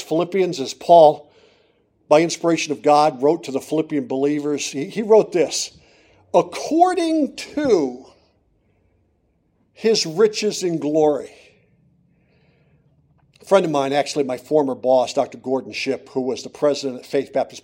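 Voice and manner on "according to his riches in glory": According to stretched out slow and high; According to held long and lifted high before his riches in glory; emphatic key phrase. Acted. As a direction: lean hard on According to, drawn out. Preacher dwells on key phrase.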